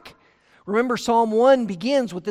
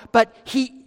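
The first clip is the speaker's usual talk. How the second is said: ordinary talk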